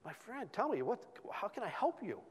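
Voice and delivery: high, soft offer of help; concerned plea. Retold or imitated. To a friend kicking his tires, high gentle concern.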